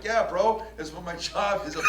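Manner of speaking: laughing retort. Retold or imitated. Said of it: "I'm like".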